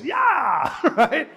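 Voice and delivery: high loud whoop; battle yell. Retold or imitated. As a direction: yell like a man charging in. Peter attacks Malchus.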